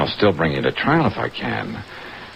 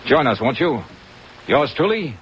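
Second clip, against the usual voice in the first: radio teaser read; 4 semitones higher than usual. Acted. Next-episode teaser in character.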